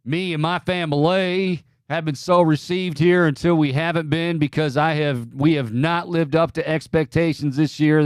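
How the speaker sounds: lightly doing Brian Kelly's put-on Southern accent; Brian Kelly impression. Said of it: "That is a horrible Brian Kelly impersonation".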